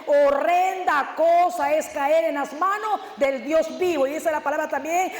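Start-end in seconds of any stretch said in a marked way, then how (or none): 0.1-4.1 very slow, drawn-out verse; grave scripture read at half speed; slow solemn reading. Shown as a demonstration. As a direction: stretch every word of "Horrenda cosa". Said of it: "Y dice la palabra más adelante".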